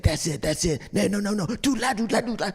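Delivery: high, animated imitation of an eager teacher; worked-up teacher singing out the syllables; excited coaching. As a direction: urge the student on, pitch climbing high.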